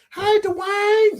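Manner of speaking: put-on high-pitched, drawn-out country accent. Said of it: "that country accent".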